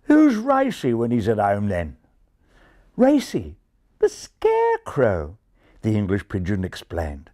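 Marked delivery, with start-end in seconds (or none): 0.0-2.0 broad Australian pigeon voice; Australian twang; Australian accent. Acted. 3.0-5.4 high, slow refined explanation; strained patience with a dim listener; a little tired of it. Acted. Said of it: "becoming a little tired".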